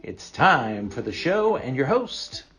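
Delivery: show announcer. Acted. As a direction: announce the host like a game-show intro.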